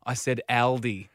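very slow, despairing mock exasperation; exasperated colleague. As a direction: spell it out slowly in despair. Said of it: "His head goes into his hands".

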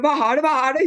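a light impression of a sister panicking; imitating worried sister. Sister asking her crying sibling in the car, mock worried. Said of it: "Hun ble helt stresset".

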